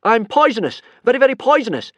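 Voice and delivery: I'm poisonous yelled high and loud; very very poisonous in a high loud shout; panicked shout. Acted. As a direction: yell poisonous desperately. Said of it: "Hiccup shouted out".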